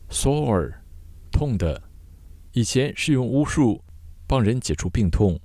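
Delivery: ordinary talk, nothing marked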